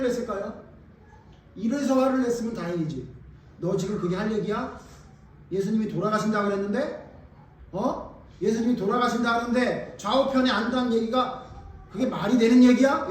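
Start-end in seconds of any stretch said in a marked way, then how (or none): 3.6-13.1 acted outrage, building to a loud peak; indignant rebuke. Disciples scolding two others over Jesus's coming death, acted indignant rebuke. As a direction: scold in disbelief, louder with each question. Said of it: "이렇게 해서 화를 냈나요".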